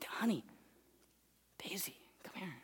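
put-on soft whispered call to a child; dad whispering to his daughter at night; gentle whisper. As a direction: hush it, calling her close.

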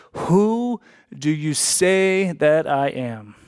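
slow, weighty, drawn out; slow solemn question. Acted. Preacher putting the central question to the church, slow and weighty. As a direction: lay the question down heavily.

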